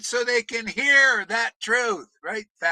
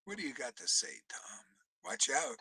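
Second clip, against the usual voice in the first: whisper; 15 dB quieter than usual. Real, not acted. Preacher turning to Tom in a hushed whisper.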